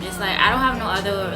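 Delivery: ordinary delivery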